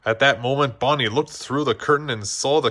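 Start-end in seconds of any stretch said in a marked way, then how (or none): none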